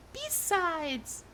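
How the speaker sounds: high, soft female voice; high, light put-on voice; Spirit. Shown as a demonstration. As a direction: lift it high and keep it soft. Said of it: "Spirit can be".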